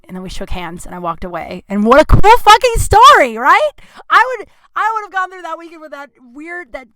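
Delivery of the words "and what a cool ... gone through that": high, loud thrilled exclaim; excited whoop. Real, not acted. Comic tells the crowd her story, loud excited whoop. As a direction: belt it out, delighted with the story.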